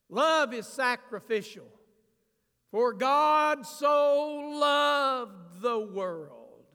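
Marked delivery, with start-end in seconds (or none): 2.7-6.7 slow, loud, weighty reading; drawn-out proclaimed verse; solemn scripture quote. Acted. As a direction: lean on each word like holy writ.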